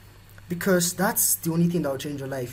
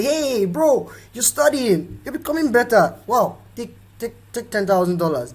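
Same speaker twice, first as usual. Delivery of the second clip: higher-pitched, enthusiastic greeting easing off; excited mock offer; 6 semitones higher than usual. Imagined billionaire offering money to a student, mock enthusiasm. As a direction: act out an eager congratulation. Acts out imagined handout from Bill Gates.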